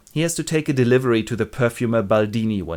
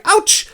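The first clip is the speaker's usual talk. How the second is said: high loud yelp of pain; a squealed hammed-up ouch; mock cry of pain; 7 dB louder than usual. Mother in labor yelps in pain.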